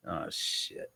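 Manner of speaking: hushed, dismayed acting-out; retold sinking realisation. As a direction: let it out under your breath. Retold dawning realisation, under breath.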